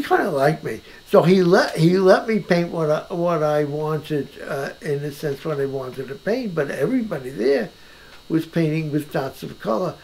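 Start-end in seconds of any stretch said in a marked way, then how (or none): none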